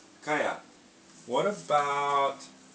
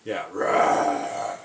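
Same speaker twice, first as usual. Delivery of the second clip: lion roar; 6 semitones lower, 6 dB louder than usual. Shown as a demonstration. Showing lion sound to toddler.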